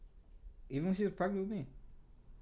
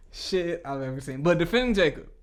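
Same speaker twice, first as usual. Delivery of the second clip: normal talking voice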